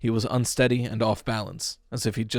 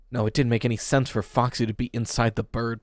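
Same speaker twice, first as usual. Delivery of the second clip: ordinary delivery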